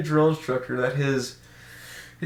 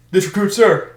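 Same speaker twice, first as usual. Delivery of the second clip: loud, higher mock recruit reply; recruit answering; 5 semitones higher, 6 dB louder than usual. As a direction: sound off like a recruit. Recruits answering the drill instructor.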